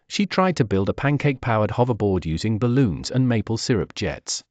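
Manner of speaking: ordinary delivery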